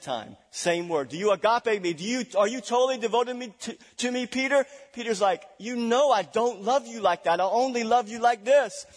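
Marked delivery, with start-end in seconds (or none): 1.1-4.6 higher, louder, fast pressing; a hint of an insistent Jesus; insistent question. 5.6-8.9 higher, louder protest; a hint of a defensive disciple; defensive Peter. Peter's limited answer.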